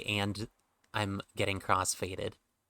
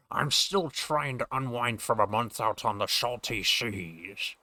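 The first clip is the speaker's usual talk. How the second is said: slow Scottish sailor, sh for s; Sean Connery pirate; 2 semitones higher, slower than usual. Acted. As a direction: say the s sounds as sh, slowly. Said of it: "Sean Connery aspect of me doing this voice".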